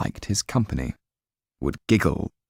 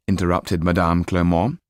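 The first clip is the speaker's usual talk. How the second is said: plain reading voice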